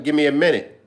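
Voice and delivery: normal talking voice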